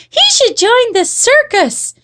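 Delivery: put-on high-pitched girlish gush; gushing girls. A narrator's reading. Girls cheering their brother's wire tricks, gushing high. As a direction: pipe up high with excitement. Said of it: "gushed the girls".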